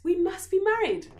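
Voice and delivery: very high, loud, swooning princess. A narrator's reading. Princess swooning over the beggar Brombu. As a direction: gush with adoration. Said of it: "said the princess".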